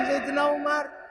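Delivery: ordinary talk, nothing marked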